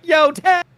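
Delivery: "Yotai" shouted very high and loud; Colin Prime's Yotai. Retold or imitated. Mimicking how chat says the game's title, exaggerated high "Yotai". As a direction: squeal "Yotai" with the last syllable lifted. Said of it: "the way people are saying Yotai, like Colin Prime in the chat".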